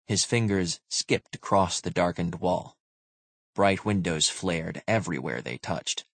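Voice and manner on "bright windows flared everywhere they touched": ordinary delivery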